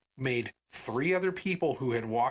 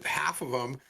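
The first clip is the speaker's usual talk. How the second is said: ordinary talk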